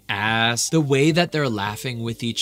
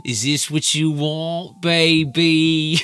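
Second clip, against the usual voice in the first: slow, hammed-up cockney come-on, building louder; cockney dirty talk; 5 semitones higher, 5 dB louder, slower than usual. Imagined cockney lover in the bedroom, mock dirty talk. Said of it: "imagine the dirty talk in the bedroom".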